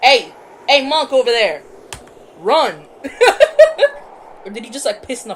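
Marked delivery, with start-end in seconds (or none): 0.0-2.8 loud shouted warning; calling out. Real, not acted. Calling out to game character. 2.9-4.4 real uncontrolled laugh; loud high laugh. Real, not acted. Laughs at own joke.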